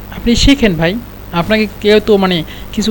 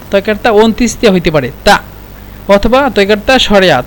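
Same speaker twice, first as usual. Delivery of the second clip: usual speaking voice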